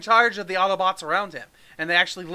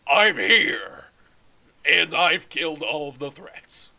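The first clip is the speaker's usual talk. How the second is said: lightly voiced calm hero; a hint of a slow, solemn Optimus; heroic leader voice; 3 semitones lower, slower than usual. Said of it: "Optimus reply".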